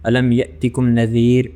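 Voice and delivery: الم یأتکم نذیر slow, with a clear ذ between the teeth; recited with care. Shown as a demonstration. Teacher recites for students, slow and clear. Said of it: "زال اور وا جب بھی پروناؤنس".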